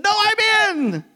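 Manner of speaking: put-on a kid standing his ground; boy insisting. David insisting to the king he will fight, high and drawn out. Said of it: "He said".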